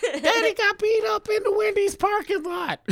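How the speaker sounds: very high shrill shout of self-pity; a hammed-up whine from the humiliated father; mock whining dad. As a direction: wail it like a beaten dad.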